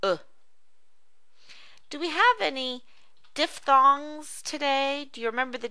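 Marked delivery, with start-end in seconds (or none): 0.0-0.2 "uh" as the brief vowel in look; short double O sound. Shown as a demonstration. Teacher demonstrating the short double O, "uh" as in look. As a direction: sound out "uh" alone, like the middle of look. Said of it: "short double O sound".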